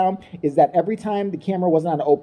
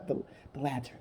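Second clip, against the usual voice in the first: soft, hushed Meghan; almost whisper it; 5 semitones lower, 15 dB quieter than usual. Retold or imitated.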